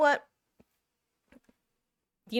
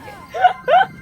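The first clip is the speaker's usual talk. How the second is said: a sudden high-pitched squeal of a laugh, loud; 14 dB louder than usual. Real, not acted. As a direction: burst into a squeaky, delighted laugh. Laughing at a viewer's follow.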